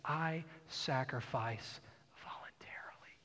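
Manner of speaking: slow, hushed words fading into a whisper; quiet quote slipping into a whisper; hushed Jesus. Acted. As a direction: say it gently, letting the last word fall to a breath. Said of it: "and he said".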